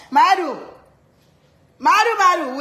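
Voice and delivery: loud, high call for someone. Real, not acted. Mother calling Maru to come over, loud holler. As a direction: holler the name to summon them.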